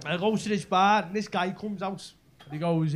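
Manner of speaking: usual speaking voice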